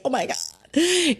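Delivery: high, slow mock-panicked gasp of oh my God. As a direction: act out a client panicking at an urge. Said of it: "not panicking".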